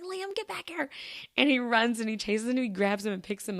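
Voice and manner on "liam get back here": high, soft mock holler; dad calling child. Dad chasing giggling kid in store, mock calling out. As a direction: imitate a dad calling from afar, held back.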